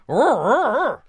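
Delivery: high growling rrr; mechanic growls. Shown as a demonstration. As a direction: growl it up loud. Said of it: "A whole boat".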